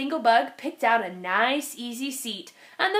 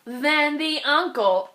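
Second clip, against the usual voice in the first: ordinary delivery, nothing marked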